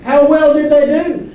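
rousing call from the pulpit. Real, not acted. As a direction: throw the question out to the room. Preacher calls out to crowd.

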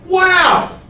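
very high, loud pretend wow; hammed-up astonished exclamation; mock amazement. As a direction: blurt it out, hugely overdone. Mock misquote of Isaiah's reaction.

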